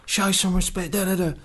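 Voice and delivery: high, strained mock telling-off; imitating Bobby's rage. Manager defends his staff in a hammed-up telling-off. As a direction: do the boss losing his temper. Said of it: "he went".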